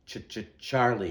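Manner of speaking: teeth chattering with cold; shivering. Acted. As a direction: stammer it out through the shivers.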